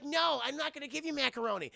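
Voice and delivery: high put-on voice, slowing at the end; Crystal. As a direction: play a simple, eager tagalong. Acts Crystal replying.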